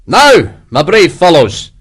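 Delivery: put-on rally the men in a broad Scottish accent; broad Scottish shout. A narrator's reading. Commander addressing his men before battle, shouting in Scottish. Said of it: "shouted in his broad Scottish accent".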